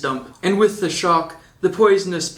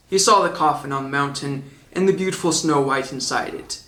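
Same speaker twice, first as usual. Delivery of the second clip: ordinary delivery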